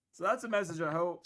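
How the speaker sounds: normal talking voice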